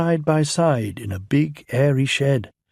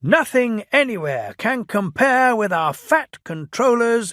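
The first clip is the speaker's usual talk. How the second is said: welcoming cheer; 11 semitones higher, 3 dB louder than usual. A narrator's reading. People cheering engines home.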